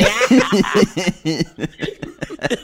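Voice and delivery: helpless real laugh. Real, not acted. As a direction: crack up mid-line. Laughs after goblin line.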